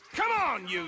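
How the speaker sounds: yelled high and loud at the man; angry shout. Acted. Angry ex-deputy shouting down a hillbilly moonshiner. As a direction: bark it at him like you mean a fight. Angry confrontation with Billy Lime.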